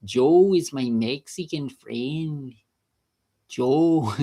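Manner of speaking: slow, put-on Mexican accent. Shown as a demonstration. Showing off accents about a friend, slow Mexican accent. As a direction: draw it out in a mock Mexican accent. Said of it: "Mexican accent".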